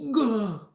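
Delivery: loud, high-pitched "gah" yell; sudden meaningless shout, very high and loud; shouted nonsense cry. Shown as a demonstration. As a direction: yell a wordless blurt. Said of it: "might as well shout".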